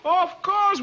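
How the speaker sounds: excited. Real, not acted. Delighted at Ricky's name.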